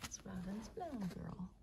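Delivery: soft, low, whispery praise; hushed tenderness. Real, not acted. Owner praising the mama dog by her puppies, hushed. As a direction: murmur gently to the mother.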